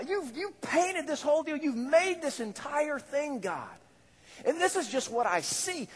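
awestruck prayer. Retold or imitated. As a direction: marvel at God under the stars. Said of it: "just standing there just going".

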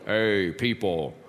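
mock booming God. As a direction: play a threatening God from the heavens, deep and deliberate. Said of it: "just start speaking real loudly".

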